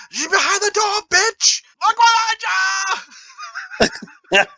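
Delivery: imitating mother's high-pitched yell, stretched at the end. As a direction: shriek a warning at the screen like Mom. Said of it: "she yells at the T V".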